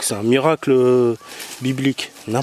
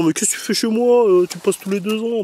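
imagined host's puzzled challenge, pitched higher; mock protest; 7 semitones higher than usual. Visitor mimics the garden owner finding him there, mock protest. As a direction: play the host questioning an uninvited guest, higher voice. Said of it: "Il va dire".

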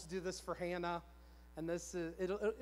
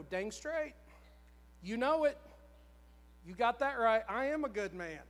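louder, punchy retold self-assurance; cocky conviction; 2 semitones higher, 4 dB louder than usual. Husband now agreeing with his wife, cocky conviction. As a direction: fire back fully convinced, playing it up. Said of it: "'Cause I just knew it. I just felt it".

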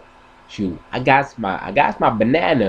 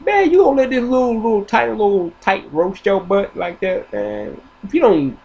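dog's dad; 10 semitones higher than usual. Retold or imitated. Dad scolding his son to roast back, high and fast. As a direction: push him to fight back. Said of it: "And your dad be like".

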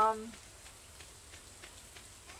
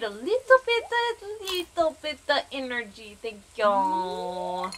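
playful put-on voice; 5 dB louder than usual. Streamer playfully begging the game for energy, silly voice.

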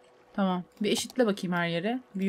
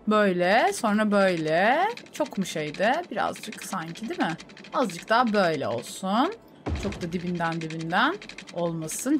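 ordinary delivery

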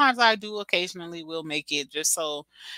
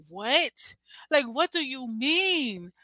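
high, drawn-out mock disbelief; retold shocked disbelief; 8 semitones higher, much slower than usual. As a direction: dramatically wail it in slow disbelief. Retells her shocked reaction to husband.

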